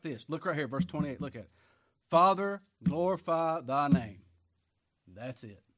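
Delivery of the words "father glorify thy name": loud, slow plea to heaven; ringing prayer. Acted. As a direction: call Father out with full voice. Quoting Jesus' prayer aloud.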